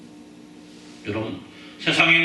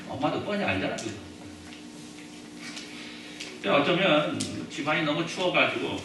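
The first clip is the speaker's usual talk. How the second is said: ordinary talk, nothing marked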